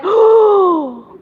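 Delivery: high, drawn-out mock gasp; long high breath of amazement; amazed gasp. Woman hearing she gets a free house, amazed.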